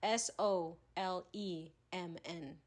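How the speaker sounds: S O L E M N spelled slowly, letter by letter; slow, deliberate letter by letter spelling. Shown as a demonstration. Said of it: "Say this word".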